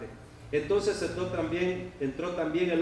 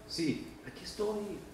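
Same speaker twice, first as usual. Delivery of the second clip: much softer, slow and gentle; soft reassuring; 3 semitones higher, 9 dB quieter than usual. Acted. Jesus gently assures weeping Mary, soft and slow. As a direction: quietly say it as a reassuring whisper.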